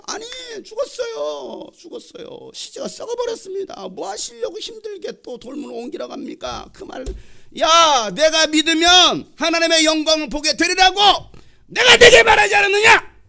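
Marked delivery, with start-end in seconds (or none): 0.1-6.7 whining protest. Acted. Martha argues the body has rotted, whining. 7.6-13.2 high, very loud exasperated yell; angry shout. Acted. Frustrated Jesus rebukes doubting Martha, shouting angrily. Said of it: "예수님 화가 났어요".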